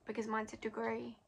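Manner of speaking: ordinary talk, nothing marked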